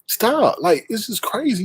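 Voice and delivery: ordinary talk, nothing marked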